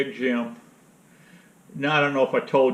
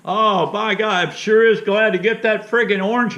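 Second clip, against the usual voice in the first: imitating Jim; 7 semitones higher, 5 dB louder than usual. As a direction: drag it out like a cranky old friend. Jim voiced in retold call.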